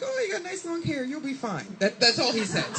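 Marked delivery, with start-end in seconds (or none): 0.0-1.6 high, softened principal impression; mock reassuring principal. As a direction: imitate a principal approving lightly. Principal reassuring a new pupil.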